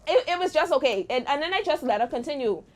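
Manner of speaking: ordinary talk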